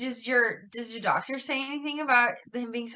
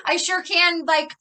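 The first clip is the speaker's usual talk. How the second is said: mock exasperated teacher; 5 semitones higher, 4 dB louder than usual. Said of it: "where I'm like".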